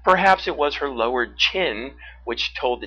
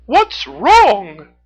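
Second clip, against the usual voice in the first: very high, loud raccoon question; Red; 11 semitones higher, 14 dB louder than usual. A narrator's reading. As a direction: ask outright in a high raccoon voice. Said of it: "Red the raccoon finally asked".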